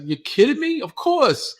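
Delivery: high-pitched incredulous delight; eager yes. Retold or imitated. Acting out own eager reply.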